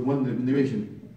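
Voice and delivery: ordinary talk, nothing marked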